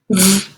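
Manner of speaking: "v" as a soft held buzz; just the consonant "v", buzzing; isolated phoneme. Shown as a demonstration. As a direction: sound out "v", not "vee". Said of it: "substituting phonemes".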